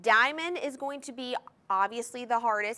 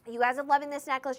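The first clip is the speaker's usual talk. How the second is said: ordinary talk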